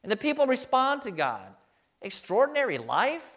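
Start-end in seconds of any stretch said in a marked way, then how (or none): 2.0-3.3 Extraordinary life rising as a doubtful question; incredulous rising echo. Pretend. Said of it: "With a big question mark".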